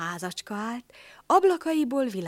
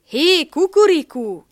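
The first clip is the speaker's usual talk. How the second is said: high, loud call across the yard; donkey hails the rooster on the fence, loud and high; 9 semitones higher, 13 dB louder than usual. A narrator's reading. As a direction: shout up to the rooster. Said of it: "kiáltotta feléje Füles".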